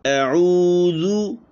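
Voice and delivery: slow chanted अऊज़ु with rounded lips; recitation demo. Shown as a demonstration. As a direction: draw out अऊज़ु like Quran recitation. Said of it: "होंठों को गोल करना है".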